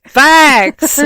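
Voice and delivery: a loud, high burst of "Facts."; "Facts." blurted high and loud in agreement; emphatic agreement. Real, not acted. Emphatic agreement with friend's take.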